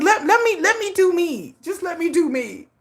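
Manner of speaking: high, insistent plea fading down; high mock plea; pleading. Pleading to friend.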